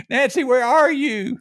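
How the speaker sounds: high, slow mock-panicked yell for Nancy; hammed-up fright, calling for help; panicked call to wife. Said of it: "I'd say".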